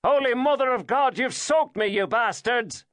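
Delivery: high, loud Irish shout after the car; soaked driver yelling in an Irish voice; furious shout. A narrator's reading. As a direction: bellow at the splashing car. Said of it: "he shouted after it".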